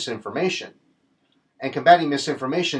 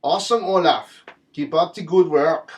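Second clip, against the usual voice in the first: a mock German accent, louder and higher, praising the chancellor; bad German accent; 3 semitones higher, 5 dB louder than usual. Vlogger hailing Olaf Scholz in a mock German accent. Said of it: "My accent's terrible".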